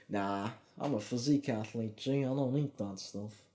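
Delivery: quiet put-on American-British accent; mock tough-guy accent, quiet and put-on; odd accent. To viewers, joking about turning down desserts, in a put-on accent. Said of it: "I don't know where this accent came from".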